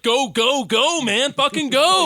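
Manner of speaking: high, loud urging yell; cheering shout. Retold or imitated. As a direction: yell them on from the seat. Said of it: "even I was like".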